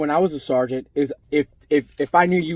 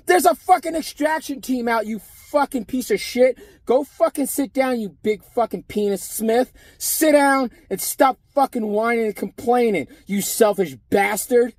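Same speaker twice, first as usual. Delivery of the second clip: cursing him out; 8 semitones higher than usual. Retold or imitated. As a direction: imitate the boss tearing into him. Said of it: "Antonello had to curse his ass out".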